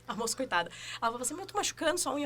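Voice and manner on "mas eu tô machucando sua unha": mild imitation of the manicurist. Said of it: "A moça falou assim".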